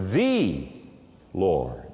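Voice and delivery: "Lord." dropped low and drawn out; stress on Lord. Shown as a demonstration. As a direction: lean down on "Lord." as the weight of the phrase. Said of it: "just emphasize each word".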